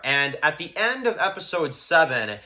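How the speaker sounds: ordinary talk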